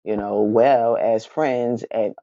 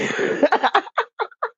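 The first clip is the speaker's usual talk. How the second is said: a burst of high helpless laughter; high squealing laugh. Real, not acted. Breaks up laughing mid story.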